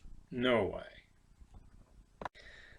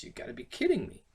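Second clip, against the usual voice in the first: surprised disbelief; 11 semitones higher than usual. Shown as a demonstration. Demo, very high.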